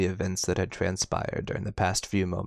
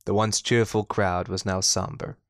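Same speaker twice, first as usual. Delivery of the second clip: ordinary delivery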